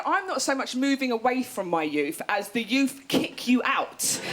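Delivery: usual speaking voice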